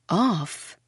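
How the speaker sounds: plain reading voice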